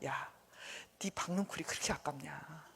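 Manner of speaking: hushed, near-whispered question; God's quiet reproof. Acted. God asking Jonah about his vine, quietly reproving. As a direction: ask it very softly, reproving. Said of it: "그 하나님 뭐라 그래요?".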